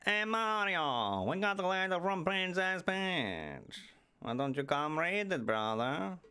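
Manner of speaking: teasing Luigi calling Mario. Acted. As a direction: call to your brother like a cartoon sidekick. Luigi voiced speaking to Mario.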